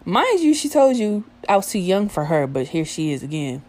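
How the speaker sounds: usual speaking voice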